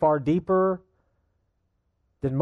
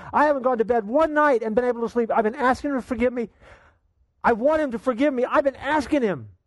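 put-on pleading boy; 8 semitones higher than usual. Eight-year-old confessing to his father, high and pleading. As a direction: beg desperately through tears.

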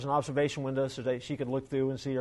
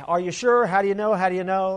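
eager disbelief; 5 semitones higher, 7 dB louder than usual. Retold or imitated. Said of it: "she's like".

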